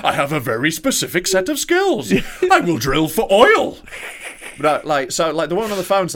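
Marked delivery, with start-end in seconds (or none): 0.0-3.8 slow, loud movie voice through laughter; goofing on the movie-villain threat, laughing; laughing impression.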